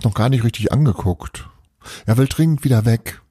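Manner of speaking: ruhig vorgelesene Weihnachtsgeschichte; erzähl die Geschichte wie beim Vorlesen. A narrator's reading. Reads a Christmas story aloud. (Erzählerin liest Kindern eine Adventsgeschichte vor)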